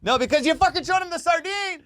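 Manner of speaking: high-pitched exasperated retort; mock outburst. Angler blaming buddy for showing the sardine, mock outburst. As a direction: blame your buddy in a high, acted yelp. Acted quarrel with fishing buddy.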